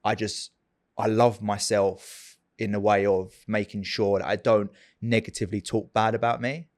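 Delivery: usual speaking voice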